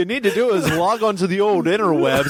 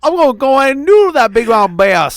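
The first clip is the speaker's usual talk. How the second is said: hillbilly voice; 7 semitones higher, 7 dB louder than usual. Pretend. Hillbilly noodling voice over music.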